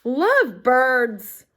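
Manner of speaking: slow, loud cooing; teacher gushing to the class; swooning. Acted. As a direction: savour the word dreamily.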